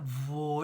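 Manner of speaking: Vo- started without teeth on the lip; wrong V sound. Shown as a demonstration. Teacher showing children the wrong way. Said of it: "it sounds wrong".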